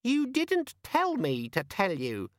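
very high, slow, sulky gadget voice; sulky demon. A narrator's reading. Organizer demon sulking at Vimes. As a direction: pout it out.

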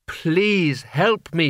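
pleading. A narrator's reading. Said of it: "she said".

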